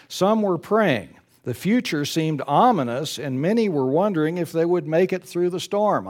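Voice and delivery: reads the story aloud to the congregation; paced storybook narration, lifting the drama; story reading. Acted. Preacher reading a story to his congregation, in a storytelling voice.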